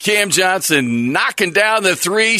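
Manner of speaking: announcer call. Pretend. Announcer three-point call.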